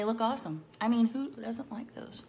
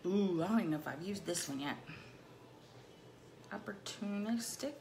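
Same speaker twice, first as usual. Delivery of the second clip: ordinary talk, nothing marked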